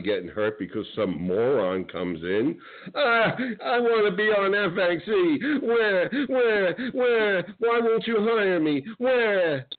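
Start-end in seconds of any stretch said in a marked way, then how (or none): 2.9-9.7 high mocking voice of the wannabe wrestler; mocking whiny wannabe. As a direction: pitch it up high and whiny like a pathetic fan.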